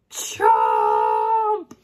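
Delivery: deep, loud, punchy CHOMP. Acted. Fish bites Penelope's finger, loud deep chomp. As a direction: bark the bite sound out big.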